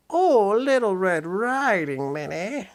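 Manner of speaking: high, slow squeak; squeaky voice. Acted. Disguised Pete greeting Red, high slow squeak. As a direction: squeak it like a fake granny. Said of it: "he squeaked".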